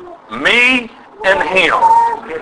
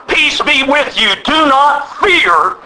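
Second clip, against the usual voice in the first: slow, raised proclamation; God reassuring Gideon; 4 semitones higher, slower than usual. Retold or imitated. The Lord speaking to timid Gideon, slow proclamation. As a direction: build slowly to a high, drawn-out promise. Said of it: "he simply said it like this".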